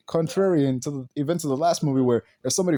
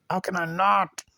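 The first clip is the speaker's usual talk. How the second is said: higher, insistent plea; exasperated; 4 semitones higher than usual. Real, not acted. Reviewer unable to stop questioning the film, exasperated.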